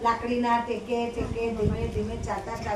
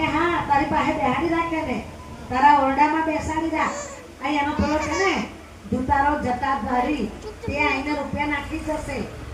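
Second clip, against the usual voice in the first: put-on higher, louder sneering old woman's voice; taunting scold; 4 semitones higher, 3 dB louder than usual. Old mother jeers at her bedridden son, higher and louder. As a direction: jeer at him like a spiteful old mother.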